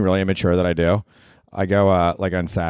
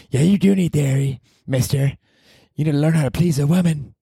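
put-on high teasing girlfriend voice; mock her, teasing him; girlfriend impression; 8 semitones higher than usual. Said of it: "That's my girlfriend".

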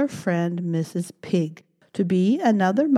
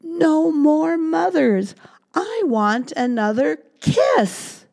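loud, higher, slow shout; shouting demand; 10 semitones higher, 4 dB louder, slower than usual. Acted. Baby mouse jumps up demanding a kiss, loud slow shout. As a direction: stamp and shout it out. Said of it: "jumped up and shouted".

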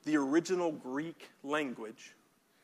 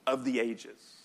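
usual speaking voice

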